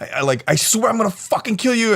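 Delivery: very high, hammed-up raging squeal; high-voiced mock outrage; mock furious threat. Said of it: "he gets so mad".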